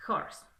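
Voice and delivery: "Horse" with an audible, raspy Spanish-jota H at the start. Shown as a demonstration. Teacher explains the H isn't mute, "Horse" with a J sound. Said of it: "se pronuncia como con una J".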